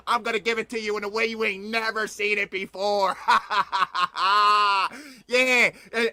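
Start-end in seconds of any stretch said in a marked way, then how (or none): 3.2-5.0 a laugh that edges toward crying. Real, not acted. Host laughing at his grim news, half crying. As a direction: laugh it out though you feel sad. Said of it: "and I'm laughing now".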